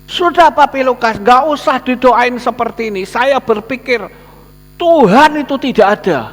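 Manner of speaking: high, loud defiant voice; agitated man refusing. Retold or imitated. Sick man refusing the pastor's prayer, high and loud defiant. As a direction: shout him down, refusing prayer.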